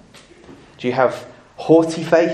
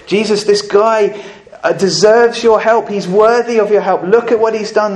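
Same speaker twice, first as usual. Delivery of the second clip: pitched up and pushed harder, pleading a case; insistent higher plea; 6 semitones higher, 5 dB louder than usual. Retold or imitated. Elders urging Jesus to help the centurion, insistent and loud. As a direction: press the case for him, loud and high.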